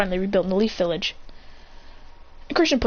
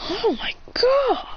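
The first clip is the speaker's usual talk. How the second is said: much higher, slow stretched groan of disbelief; slow, high exasperated sigh; 13 semitones higher, much slower than usual. Acted. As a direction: drag it out in exasperation. Character exasperated, put-on voice.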